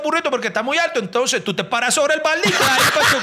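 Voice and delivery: high, eager voice of a local explaining; chirpy Caribbean Colombian local; coastal local man. Retold or imitated. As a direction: play the helpful village guy.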